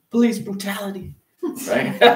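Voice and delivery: preaching at full force. Acted. Pastor crying out to his congregation, loud preaching. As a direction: build it up and hammer the point home.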